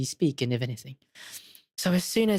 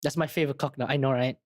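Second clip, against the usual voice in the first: ordinary talk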